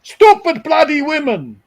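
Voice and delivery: put-on loud, high German-accented snap. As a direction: bark it like a German father. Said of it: "He was definitely German".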